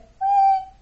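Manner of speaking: high loud bird "wee"; chirp. A narrator's reading. Bird returning to health, high chirp. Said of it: "chirped Lucille".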